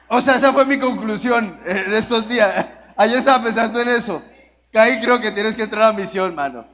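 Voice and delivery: laughing while teasing a friend; teasing Caín with a laugh; laughing tease. Real, not acted. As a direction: rib him through laughter.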